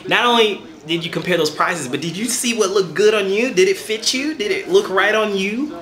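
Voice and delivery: ordinary talk, nothing marked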